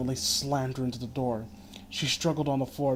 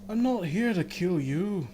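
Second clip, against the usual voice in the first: higher, slow, drawn-out killer's taunt; slow menacing villain; 5 semitones higher than usual. Acted. Killer taunting the beaten narrator, slow and sinister. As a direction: let each word hang, cold and slow. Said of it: "simply laughed again and replied".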